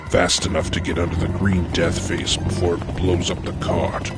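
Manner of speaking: plain reading voice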